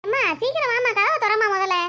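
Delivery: high, urgent plea to mother; urgent calling. Acted. Desperate child hurries mother to door. (அவசரமாக உயர்ந்த குரலில் அழைப்பு)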